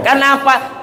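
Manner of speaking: ordinary delivery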